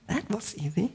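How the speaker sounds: breathy copy of the button's voice; imitated easy button. Pastor copies the "that was easy" button for the congregation, light imitation. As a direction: echo the gadget's slogan lightly.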